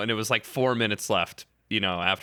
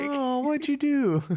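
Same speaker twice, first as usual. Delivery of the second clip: much higher, sugary mock-innocent wife; sweet wife voice; slower than usual. Wife greeting her husband sweetly after finding the mask, high mock-sweet voice. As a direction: coo it like a wife playing dumb before she pounces.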